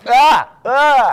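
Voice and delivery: loud disgusted cry; disgusted yelp. Real, not acted. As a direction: yelp in revulsion. Gross-out reaction to story.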